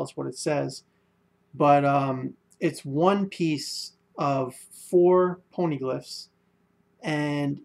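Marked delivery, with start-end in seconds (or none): none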